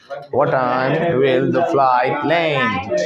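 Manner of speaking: extremely drawn-out English question; slow model sentence. Shown as a demonstration. Teacher models the flight question, very slowly. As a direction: stretch out every word for repeating.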